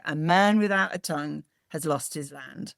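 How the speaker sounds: ordinary talk, nothing marked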